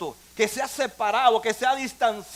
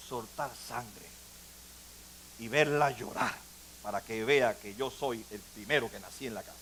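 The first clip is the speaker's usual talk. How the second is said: put-on low, much softer, hushed voice of a child confessing; hushed confession; 13 semitones lower, 13 dB quieter than usual. Pastor voicing a son's hidden cruelty to his mother, low and hushed. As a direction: drop to a low hush as if admitting a dark secret. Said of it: "Él nunca te va a decir a ti".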